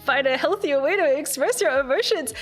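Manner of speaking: laughing through a mock lecture; laughing friend. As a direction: squeal it through laughter as the startled buddy. Friend reacts to being strangled.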